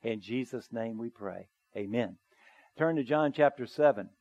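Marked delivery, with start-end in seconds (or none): 0.0-2.2 prayer. Real, not acted. Opening prayer before sermon.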